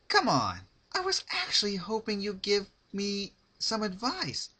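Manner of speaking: high, bubbly, sing-song; cheery Pinkie voice. A narrator's reading. Pinkie asking her captive for advice, high and bubbly. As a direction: chirp it like a sweet party pony.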